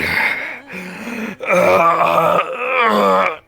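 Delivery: anguished groan. Acted. Dying man in video.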